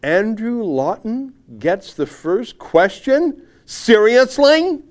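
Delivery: loud, slow incredulous read, pitched high; mock outrage. Host reading a columnist's snobbish tweet aloud, mock outraged. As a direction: read it out aghast, hitting each phrase hard.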